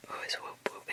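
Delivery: ordinary talk, nothing marked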